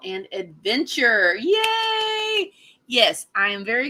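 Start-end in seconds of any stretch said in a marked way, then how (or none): 1.4-2.5 high, drawn-out cheer; excited high-pitched cheer. Real, not acted. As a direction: let out a high "yay" and hold it. Cheering the next featured show.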